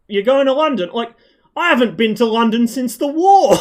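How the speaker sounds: put-on an old man stunned anyone would travel that far; amazed old man. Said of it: "very much not in that accent".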